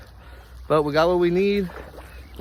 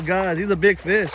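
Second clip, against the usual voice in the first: normal talking voice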